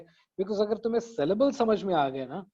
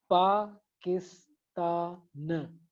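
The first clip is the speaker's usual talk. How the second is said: very slow पा ... किस ... ता ... न; syllable by syllable; much slower than usual. Shown as a demonstration. Teacher showing the final न counts, syllable by syllable. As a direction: break Pakistan into four spaced beats. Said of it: "चार syllables हो गए".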